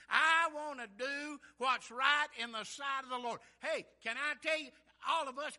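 loud, rising preacher's cadence; preaching at full force. Acted. Preacher to his congregation, preaching at full force. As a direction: preach it loud, building to the healed man's cry.

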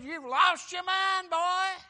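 high, loud, slow accusing yell; mock angry shout. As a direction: bellow it at him like a scornful governor. Festus accuses Paul, acted.

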